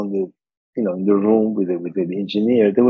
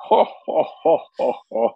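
mock jolly laugh; 5 semitones higher than usual. Sonny laughing on phone.

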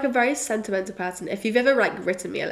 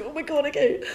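panicked; 6 semitones higher than usual. Retold or imitated. Told the ticket is wrong.

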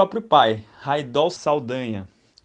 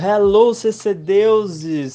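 saudação de picadeiro, bem alta; ringmaster; 5 semitones higher, 8 dB louder, slower than usual. Pretend. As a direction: chame a plateia como no circo. Continues circus-style opening. (mestre de cerimônias animado)

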